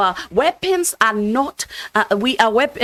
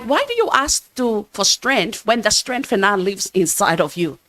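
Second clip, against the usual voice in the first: ordinary talk, nothing marked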